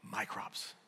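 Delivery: whispered, clutching tone; mock miser's whisper; whispered mock greed. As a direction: breathe it like a miser. Imitating the rich fool.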